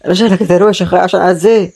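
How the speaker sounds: usual speaking voice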